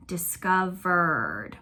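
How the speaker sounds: discovered drawn out slowly, syllable by syllable; slow, stretched teacher demo of "Discovered". Shown as a demonstration. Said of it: "If you stretch out that part".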